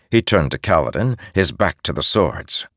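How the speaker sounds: ordinary delivery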